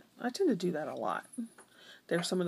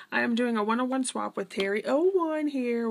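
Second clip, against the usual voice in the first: normal talking voice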